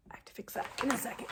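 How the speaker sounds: fast whispered aside; hurried whisper. Real, not acted. To viewers while packing items, fast whisper. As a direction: rush it under your breath.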